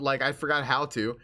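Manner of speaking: ordinary talk, nothing marked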